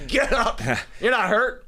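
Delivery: high-pitched mock shout; imitated coach yell. Host replaying coach's order to hurt player, mock yelling. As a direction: playfully yell like an unsympathetic coach. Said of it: "you can see him yelling".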